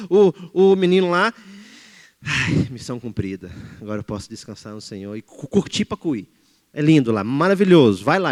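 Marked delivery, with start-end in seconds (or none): 2.2-6.3 relieved sigh. Retold or imitated.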